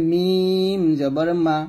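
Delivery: মিম stretched very long, then a short মা; model recitation. Shown as a demonstration. Teacher recapping lip letters for students, মিম slow and elongated. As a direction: hold the hum of মিম slowly, then release মা. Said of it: "এরকম".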